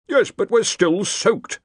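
high, slow grumble; grumbling. A narrator's reading. The soaked Dean complains, grumbling. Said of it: "grumbled the Dean".

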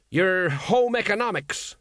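high, slow fumbling menace; desperate threat. Acted. Colon fumbles a threat at the guards, high and slow. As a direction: grab for a tough line and miss. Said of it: "took a desperate stab at it".

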